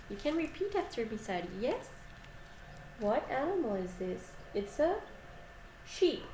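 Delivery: usual speaking voice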